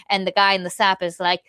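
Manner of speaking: ordinary talk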